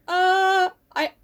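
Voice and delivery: high, hesitant stalling; non-committal. Acted. Meg answering a love confession, acted hesitant dithering. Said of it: "So her response is like".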